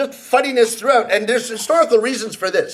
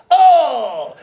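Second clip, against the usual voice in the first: reacting shout; 3 semitones higher, 4 dB louder than usual. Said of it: "went bang to see how many people would react".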